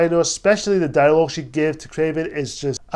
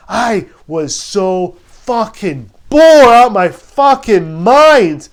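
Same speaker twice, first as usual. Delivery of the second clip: slow, louder, rising fed-up rant; bored out of his mind, shouting it; exasperated rant; 3 semitones higher, 6 dB louder, much slower than usual. Real, not acted. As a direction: drag it out, building to a loud burst on mind. Frustrated reviewer ranting about boredom.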